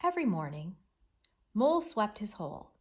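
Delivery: measured model reading with a beat after "morning"; pauses at comma and period. Shown as a demonstration. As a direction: clear break after "morning", falling close on "hole". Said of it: "Watch as I read".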